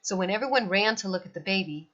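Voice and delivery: ordinary delivery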